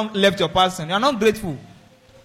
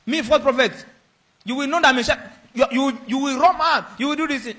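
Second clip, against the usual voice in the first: indignant, higher-pitched comeback; angry retort; 6 semitones higher than usual. Acted. Accused man snapping back at those calling him false prophet, angry. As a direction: fire back as if insulted. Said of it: "You are now angry".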